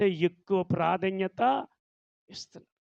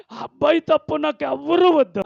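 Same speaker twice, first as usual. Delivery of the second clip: higher, louder insistence on ఎవ్వరూ; defiant outburst; 8 semitones higher, 6 dB louder than usual. Retold or imitated. Daughter defying her parents, higher and louder. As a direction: declare it defiantly.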